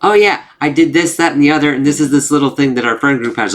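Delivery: high, eager know-it-all takeover; story hijacker. Retold or imitated. Friend takes over someone else's story, high eager chatter. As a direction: imitate someone barging in with their own tale. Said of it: "they completely take over the story".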